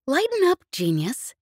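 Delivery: teasing, jumping high on lighten; grinning. A narrator's reading. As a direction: tease him with a grin. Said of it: "she said, grinning".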